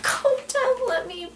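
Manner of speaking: high, teary plea; scared pleading. Retold or imitated. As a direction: beg to get off, voice cracking. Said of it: "I'm like".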